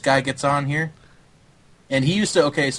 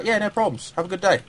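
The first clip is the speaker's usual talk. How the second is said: raised, cheery imitated European accent; jolly European accent; 6 semitones higher than usual. Coach imitating jolly European players, higher, in their accent. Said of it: "so jolly and so nice".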